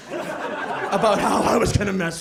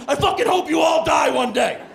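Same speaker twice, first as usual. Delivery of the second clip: high, raised-voice mock rage; hammed-up angry outburst, voice pitched up; furious curse; 7 semitones higher, 4 dB louder than usual. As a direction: curse the whole room in exasperation. Acted roommate cursing laughing friends.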